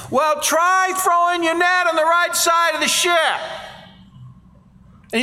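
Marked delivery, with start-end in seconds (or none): 0.1-3.4 calling out. Retold or imitated. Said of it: "yells out from the side".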